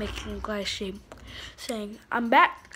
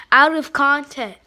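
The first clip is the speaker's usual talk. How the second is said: loud; 2 semitones higher, 14 dB louder than usual. Real, not acted. Loud spelled-out punchline.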